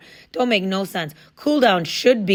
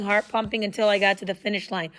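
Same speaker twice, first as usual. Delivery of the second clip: ordinary delivery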